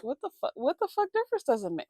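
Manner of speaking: baffled, quick retort; rushed, exasperated confusion; confused disbelief. Retold or imitated. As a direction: retell your bewilderment, pitching up as the question repeats. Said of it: "I was so confused".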